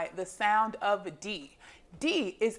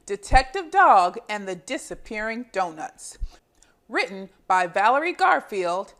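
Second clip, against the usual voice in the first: put-on lively read-aloud for young children; storybook reading; 7 dB louder than usual. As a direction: read it like a picture book to little kids. Reading picture book to children.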